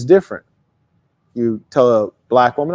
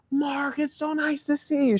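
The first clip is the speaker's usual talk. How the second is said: much higher, slow, soft gushing welcome; delighted Aunt Leslie; 11 semitones higher, 8 dB quieter, slower than usual. Retold or imitated. Speaker imitating his aunt thrilled to see him arrive. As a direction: welcome the boy like the best moment of your day. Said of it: "Aunt Leslie".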